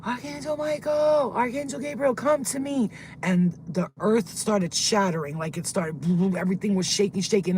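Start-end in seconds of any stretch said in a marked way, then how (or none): 0.0-2.9 high, held-back mock scream; screaming to heavens. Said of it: "I started screaming to the heavens".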